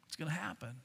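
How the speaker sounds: ordinary talk, nothing marked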